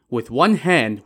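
ordinary delivery, nothing marked

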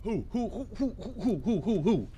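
rapid-fire owlish "who"; mock heckling. Real, not acted. Heckling a friend's claim about so many people, mocking repetition. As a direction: pepper them with questions.

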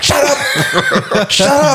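high, laughing shout of shut up; playfully shouting down a friend, laughing; laughing mock yell. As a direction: yell it through laughter at the bandmate. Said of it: "I'm like".

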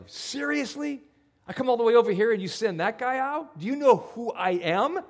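high, loud, offended general; proud indignation. Retold or imitated. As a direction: demand respect, outraged. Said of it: "he's like".

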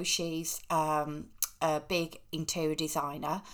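ordinary talk

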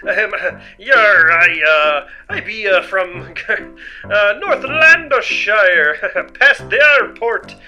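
flustered fake pirate accent with nervous laughs; faked pirate accent. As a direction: bluff a pirate backstory, stalling. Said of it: "plan to imitate a pirate".

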